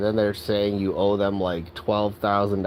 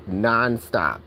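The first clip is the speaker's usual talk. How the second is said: ordinary delivery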